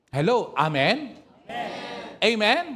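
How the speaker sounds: loud call for an amen; preacher shouting Amen. Real, not acted. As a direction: rouse the congregation. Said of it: "preacher calling congregation".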